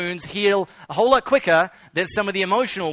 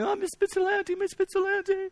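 high, softer imitation of a sobbing small boy; crying little boy; 13 semitones higher, 6 dB quieter than usual. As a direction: wail the teacher's name like a crying child. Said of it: "and he's crying".

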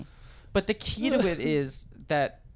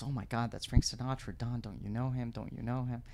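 excited date, quietly voiced; 7 dB quieter than usual. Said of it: "the woman says".

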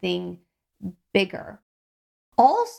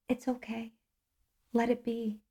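much softer, soothing comfort to a crying stranger; gentle reassurance; 9 dB quieter than usual. Retold or imitated. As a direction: quietly tell her it's fine. Comforting a crying stall lady.

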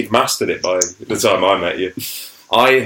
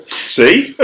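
laughing; 12 semitones higher, 5 dB louder than usual. Real, not acted. Laughing at his own joke.

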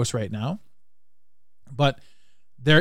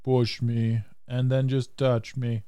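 slow, softer mock synthesized voice; Stephen Hawking impression; 2 semitones lower, 5 dB quieter, much slower than usual. Host joking as Stephen Hawking, slow robotic mock voice. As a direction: imitate a speech computer, slow and even. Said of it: "And Stephen Hawking".

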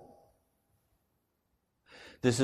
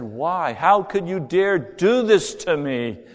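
higher, louder, slow anguished outcry; railing in anger; 9 semitones higher, 6 dB louder, slower than usual. Retold or imitated. Railing at the Lord who left him starving, higher and louder. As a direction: rage at God, voice climbing and breaking loose. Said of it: "railed against the Lord".

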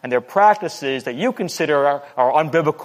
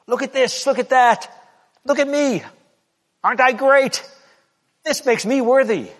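much higher, showy bragging voice; mock braggart; 10 semitones higher than usual. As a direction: preen like a self-admiring show-off, calling for attention. Preacher voices a boastful self.

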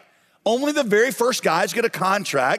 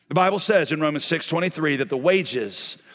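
usual speaking voice

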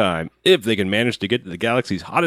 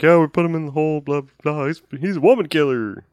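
higher, offhand gruff mechanic; 6 semitones higher than usual. Retold or imitated. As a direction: toss it off like a grease-monkey. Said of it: "the mechanic guy was just talking like".

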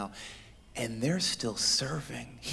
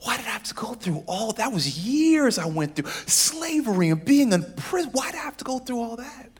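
anguished cry; 8 semitones higher, 4 dB louder than usual. Acted. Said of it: "But Joseph weeps".

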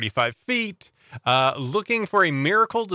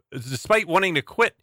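normal talking voice

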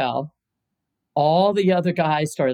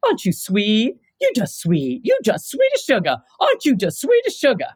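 high syrupy condescending coo, rising to much higher; mocking sweet-talk; 7 semitones higher than usual. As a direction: patronize her in a sugary sing-song. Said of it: "And he just goes".

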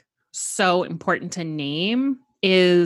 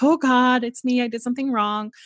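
lightly acted inner critic, higher pitch; a touch of self-blaming alarm, pitched up; 5 semitones higher, 3 dB louder, faster than usual. Inner voice blaming oneself, a lightly voiced inner critic. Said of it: "little inner critics that are like".

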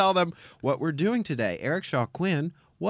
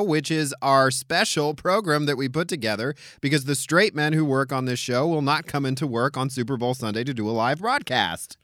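ordinary talk, nothing marked

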